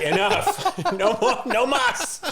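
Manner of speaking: high, laughing mock refusal; laughing protest. Recalling being fed too many fries, laughing high refusal. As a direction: wave off the fries through laughter. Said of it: "We were just like".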